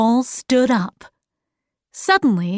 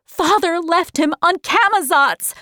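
higher-pitched, mildly raised angry cry; angry shout; 7 semitones higher than usual. A narrator's reading. Meg accuses her father, angry high outburst. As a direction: protest it angrily, pitched up. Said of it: "shouted Meg angrily".

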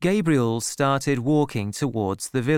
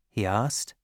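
ordinary delivery, nothing marked

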